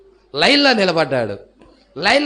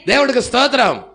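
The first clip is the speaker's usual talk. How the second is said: mild retold shout; 5 semitones higher, 5 dB louder than usual. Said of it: "కేకలు పెడతా వెళ్ళాడు".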